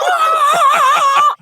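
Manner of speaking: very high, drawn-out excited yell; retold party scream. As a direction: scream back in star-struck delight. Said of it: "I'm screaming".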